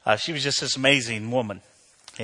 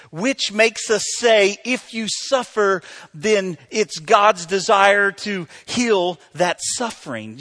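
raised, louder declamatory sermon voice; emphatic preaching; 7 semitones higher, 5 dB louder than usual. Real, not acted. Preacher reading Paul's words to the church, proclaiming emphatically. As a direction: proclaim it with deliberate, weighty phrasing.

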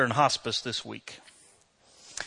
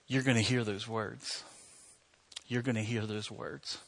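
fighting back tears; 2 semitones lower, 9 dB quieter than usual. Retold or imitated. Visitor reassuring the dying doctor, quietly fighting tears. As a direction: say it softly, fading on the repeat. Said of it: "I'm fighting the tears".

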